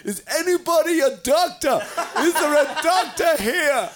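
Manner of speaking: high, laughing call for a doctor; mock frantic call. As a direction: playfully yell down the beach for help. Des running up beach calling.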